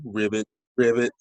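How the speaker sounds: low croaky ribbit; frog voice. Acted. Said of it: "Ribbit the frog answered".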